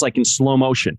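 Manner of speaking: ordinary talk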